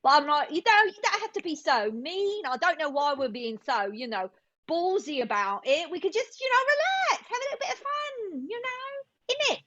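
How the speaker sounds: high, lilting British complaint; British accent climbing into a squeaky, cheeky plea. Acted. Actress asking to relax and have fun, playful British accent. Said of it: "to British".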